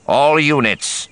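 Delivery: high, loud order over comms; radio command shout. Acted. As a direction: call the troops forward. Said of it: "keying for command frequency".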